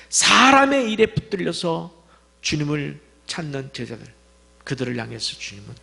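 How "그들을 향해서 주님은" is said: ordinary talk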